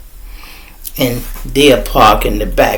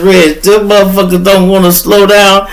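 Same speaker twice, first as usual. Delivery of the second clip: laughing while talking; 6 semitones higher than usual. Retold or imitated. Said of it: "I started laughing so hard".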